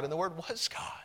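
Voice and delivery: unvoiced, soft reverent close; hushed scripture. Preacher closing the verse, whispered. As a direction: drop to a whisper on the last clause.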